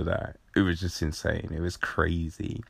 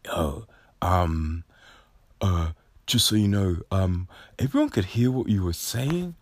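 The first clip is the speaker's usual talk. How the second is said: lightly voiced kid. Little dude warning him.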